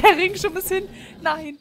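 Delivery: dismayed outcry. Real, not acted. Reacts to broken item.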